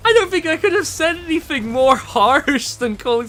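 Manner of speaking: high, amused voice broken by laughter; laughing in disbelief. Real, not acted. Gamer explaining own harsh joke, still laughing. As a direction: talk through leftover laughter.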